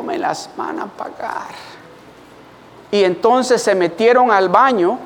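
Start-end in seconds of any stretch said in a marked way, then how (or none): 0.0-1.5 whispered vow of revenge; whispered threat. Retold or imitated. Boy vowing revenge on the bullies, whispered. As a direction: hiss the threat under your breath. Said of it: "dije yo".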